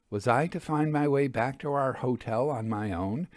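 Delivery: usual speaking voice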